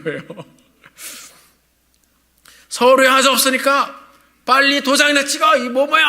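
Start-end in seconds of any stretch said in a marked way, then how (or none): 2.7-6.1 shouting back. Retold or imitated. Young man shouting back at the clerk, angry yell. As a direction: hurl the insult right back. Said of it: "하면서 확 얘기를 하는 거예요".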